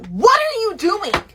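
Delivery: high, slow, alarmed question; startled question. Retold or imitated. Wife to husband hunched over her bed, a high slow alarmed question. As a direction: shaken awake and scared, demanding to know what he's doing.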